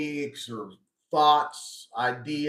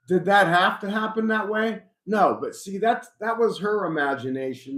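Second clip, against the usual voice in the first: ordinary talk, nothing marked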